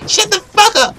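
high, loud squawk of rage; angry yell. Acted. Player silencing gloating rival, angry high yell. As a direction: cut them off, high and loud.

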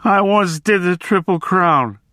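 plain reading voice

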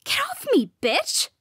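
high-pitched, loud yell; furious, voice leaping up; angry outburst. A narrator's reading. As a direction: lash out while shoving someone off. Rival shoves her away.

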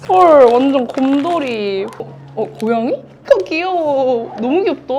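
high delighted shrieks with laughter; excited squealing. Real, not acted. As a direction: squeal with joy at a friend. Friend arrives, squeals of joy.

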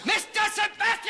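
shout. Acted. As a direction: bellow the name across the deck. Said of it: "Oh, please don't shout".

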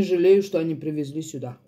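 talking while eating; chewing food. Real, not acted. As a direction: mouth partly full. Seen 4: Eats throughout, fork to mouth at 4.3 and 8.9, chewing visible.

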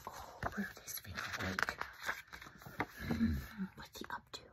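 low hushed whisper; suspicious hushed aside. Real, not acted.

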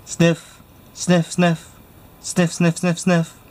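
high, quick dog sniffs; sniffing. Acted. The giant dog sniffing out the smell of barbecue. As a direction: sniff the air like a huge dog catching a scent. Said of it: "a strange and mysterious sound was heard".

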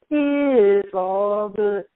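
high, slow It's all good; imitating the prank caller. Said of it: "Somebody keep calling my room".

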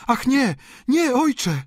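high, loud protest; alarmed high-pitched outcry; daughters crying out. A narrator's reading. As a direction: cry no to your father, high and urgent. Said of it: "zawołały obie córki".

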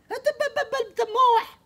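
very high, slow, loud yell; angry shout. Retold or imitated. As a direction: bellow that he's wasting your time. Neighbor yelling again.

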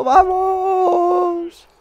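very high, loud, long cheer; celebratory scream. Real, not acted. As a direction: scream it and hold it. Celebrating the win.

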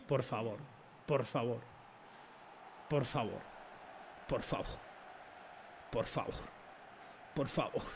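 low, hushed begging under breath; muttered pleading. Real, not acted. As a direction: whisper a desperate plea to yourself. Praying for a goal to go in.

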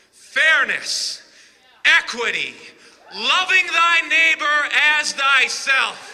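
loud, high rallying cry of values; rousing speech. Acted. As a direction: preach it to the crowd at full force.

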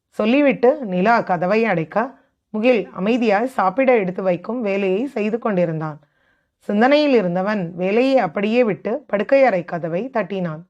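usual speaking voice

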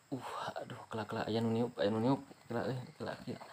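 frightened hushed whisper; breathless whisper of fear; scared whisper. Real, not acted. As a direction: whisper as if something might hear you. Scared ghost hunter whispering.

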